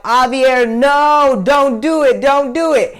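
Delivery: put-on high, loud, pleading crowd of kids. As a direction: beg him to stop before he lunges. Kids begging him to stop.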